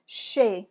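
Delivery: Chez, no z at the end; French word chez. Shown as a demonstration. Teacher models chez for students. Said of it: "let's go ahead and say chez".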